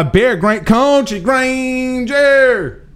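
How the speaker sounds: high, very slow stretched call of a nickname; playful drawn-out holler. Pretend. Host announcing a regular caller, playful drawn-out holler. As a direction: holler it out high and hold the last word.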